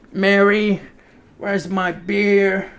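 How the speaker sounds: hammed-up Southern American man hollering for his beer; Southern American accent. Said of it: "Southern American accent".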